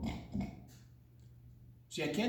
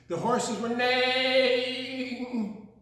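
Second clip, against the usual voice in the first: neighing; 6 semitones higher, 4 dB louder, much slower than usual. Acted. Horse neigh sung.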